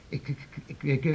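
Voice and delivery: stammering in fear, the first words breathed out; frightened stammer. Acted. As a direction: stutter helplessly, scared to speak. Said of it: "staat toch niet te stotteren".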